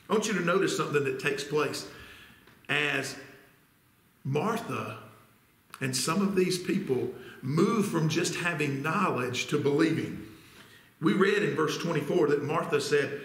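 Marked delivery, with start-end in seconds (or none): none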